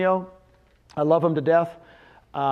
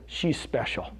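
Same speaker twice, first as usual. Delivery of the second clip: mock tearful hush; 4 semitones lower, 7 dB quieter, slower than usual. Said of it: "and tears start".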